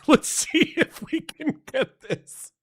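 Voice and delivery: high, giggly, squeezed words; talking through laughter. Real, not acted. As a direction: try to carry on while laughing. Laughing while working the computer.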